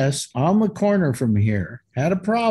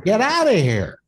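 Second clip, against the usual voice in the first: higher-pitched yell to shoo an animal; retold yell; 7 semitones higher than usual. Man telling friends how he chased a squirrel, yelling. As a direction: call it out high, falling hard on OUT. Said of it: "I'm yelling at the squirrel".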